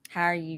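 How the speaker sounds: "How are you" slurred quickly together; run-together "How are you". Shown as a demonstration. Host testing friends, "How are you" run together. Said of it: "you know what I just said?".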